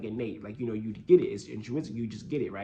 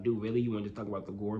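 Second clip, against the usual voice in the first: imitating annoyed fans; 5 semitones lower, 6 dB quieter, much faster than usual. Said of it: "Nanami fans are probably like".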